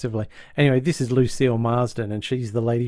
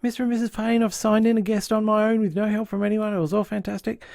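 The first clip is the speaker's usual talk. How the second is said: much higher, eager proud little voice; 9 semitones higher than usual. Retold or imitated. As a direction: chirp it out like a proud helper who did it alone. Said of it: "he's so proud and he goes".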